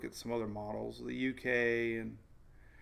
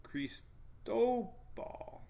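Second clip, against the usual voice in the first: "Cristobal" drawn out slowly, crees-TOH-bahl, middle syllable pitched up; stress on second syllable; 9 semitones higher, 3 dB quieter, much slower than usual. Shown as a demonstration. Showing viewers the guide's emphasis, second-syllable stress. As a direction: stretch "Cristobal" and lift the "to". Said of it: "emphasis on the second syllable".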